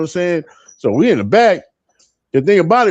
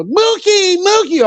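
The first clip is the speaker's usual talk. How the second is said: high, nasal-free squeal of Mookie, Mookie; Rosie Perez impression; slower than usual. Mimicking the movie girlfriend calling Mookie in a high voice. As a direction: call out Mookie in a high Bronx voice. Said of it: "that movie was out with".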